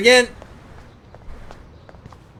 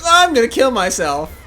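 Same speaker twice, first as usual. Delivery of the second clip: laughing imitation; 8 semitones higher, 8 dB louder than usual. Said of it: "And she's like".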